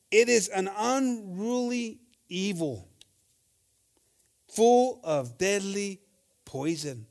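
slow, solemn Bible reading; scripture reading. Acted. Preacher reading James to the church, slow and solemn. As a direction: read the verse slowly and gravely.

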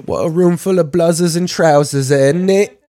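lampoon a posh British speaker; hammed-up British accent; mock posh British. Mocking Bale's British speech.

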